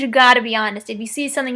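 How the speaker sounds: normal talking voice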